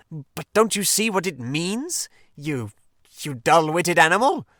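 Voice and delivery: impatient. Acted. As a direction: drag it out, then snap at him. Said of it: "cried the Rat impatiently".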